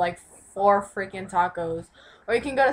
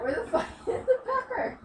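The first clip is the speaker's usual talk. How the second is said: laughing; 12 semitones higher, 5 dB quieter than usual. Real, not acted. Frantic pepper search, laughing.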